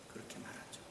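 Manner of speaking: barely audible whispered repeat; whispered echo. Shown as a demonstration. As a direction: fade it into a whisper.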